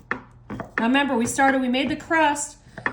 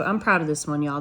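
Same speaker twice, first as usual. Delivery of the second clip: ordinary talk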